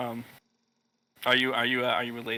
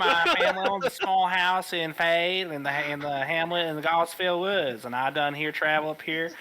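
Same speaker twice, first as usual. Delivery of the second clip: put-on southern accent; 5 semitones higher than usual. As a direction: play a folksy Southern country boy. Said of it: "speaking in a southern accent".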